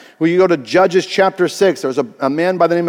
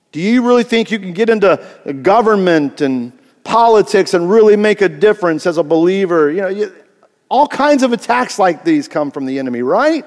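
normal talking voice

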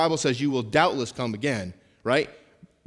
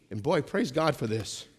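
usual speaking voice

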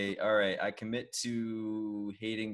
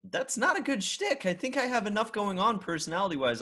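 indignant high; 12 semitones higher, 4 dB louder than usual. Real, not acted. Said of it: "protesting, high voice".

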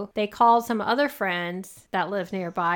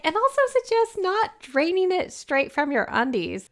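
much higher, amused sing-out; mock advice; 11 semitones higher, 3 dB louder than usual. Co-host adding joke advice to a gross story, high mock advice. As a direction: pitch the joking suggestion way up high.

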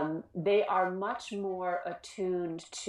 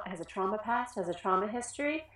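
ordinary talk, nothing marked

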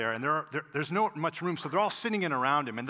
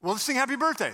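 high, loud, imitated rallying call; awkward cheery prompt; 11 semitones higher, 7 dB louder, faster than usual. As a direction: jump in loudly to cover an awkward moment. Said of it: "in this awkward moment".

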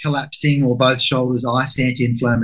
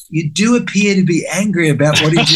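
prim inner observer; 3 semitones higher than usual. Retold or imitated. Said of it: "this little part of me goes".

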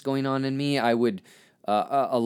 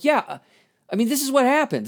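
much higher, exasperated retort; angry inner voice; 12 semitones higher, 4 dB louder than usual. Retold or imitated. Telling how he got angry, a pitched-up exasperated retort. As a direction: snap it out, fed up and pitched way up. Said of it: "I got so angry 'cause I'm like".

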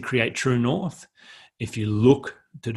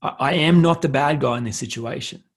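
higher, louder firm refusal; defiant stand; 5 semitones higher, 4 dB louder than usual. Retold or imitated. Standing up to a partner, defiant. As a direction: plant your feet and refuse the blame. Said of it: "I went".

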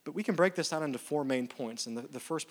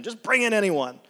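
loud, firm "Just bring in anyone" read as the host's order; loud command; 8 semitones higher, 9 dB louder than usual. Acted. Host orders servant, scripture read.

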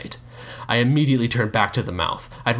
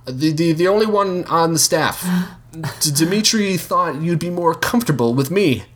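nervous stammer; 8 semitones higher than usual. Acted. Nervous rushed lie.

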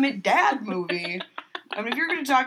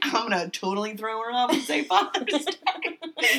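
high laughing retelling; 3 semitones higher than usual. Real, not acted. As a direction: keep laughing while you explain.

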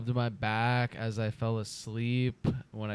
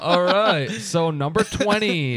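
countdown announcer; 6 semitones higher, 5 dB louder, slower than usual. Acted.

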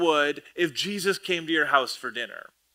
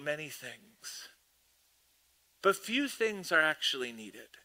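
gentle Jesus; 9 dB quieter than usual. Retold or imitated. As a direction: reassure her kindly. Jesus speaking gently to Martha.

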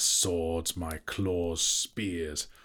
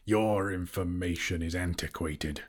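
usual speaking voice

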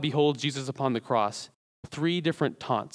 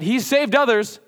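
higher and louder, flung out like a jeer; loud mock taunt; 9 semitones higher, 7 dB louder than usual. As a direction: hurl it back as the crowd's taunt. Preacher echoing the mockers' taunt.

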